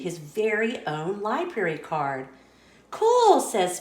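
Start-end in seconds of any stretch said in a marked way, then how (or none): 2.9-3.4 put-on much higher and louder, a delighted kid; thrilled little cat, bursting with joy; excited Pete. Pete thrilled by his own library card, excited.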